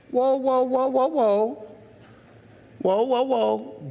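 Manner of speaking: slow playful whoa, whoa; whoa slowly. Real, not acted. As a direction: rein the congregation in. Preacher stops the excuse.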